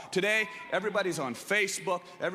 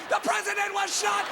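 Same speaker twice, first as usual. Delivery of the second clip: running shout; 14 semitones higher than usual. Retold or imitated. Kid running past hedges, high panicked shouting. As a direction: yell it while running around. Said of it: "I'm running around".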